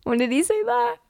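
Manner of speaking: high startled question; surprised squeak. Real, not acted. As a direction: jump up in pitch, alarmed.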